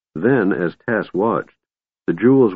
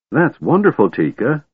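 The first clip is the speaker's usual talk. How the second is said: higher, eager kender delight; excited; 7 semitones higher than usual. A narrator's reading. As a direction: gush with excitement. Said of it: "Tass said in excitement".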